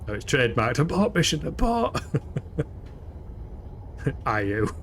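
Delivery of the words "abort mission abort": abort call rising high in a playful panic; hammed-up emergency alarm; mock panic. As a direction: yell abort like a pilot in trouble.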